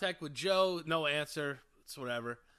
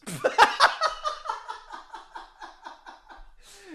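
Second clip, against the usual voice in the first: high, loud burst of laughter tailing off; long laughing fit; 8 dB louder than usual. Real, not acted. Teller cracking up at his own confession, long laugh. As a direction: crack up and keep going.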